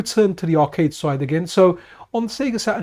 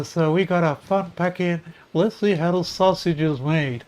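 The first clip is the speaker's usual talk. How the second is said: head back, holding the nose, nasal; nasal sick voice; 4 dB quieter than usual. Retold or imitated. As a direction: speak pinched through a held nose. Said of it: "lean my head back ... hold my nose".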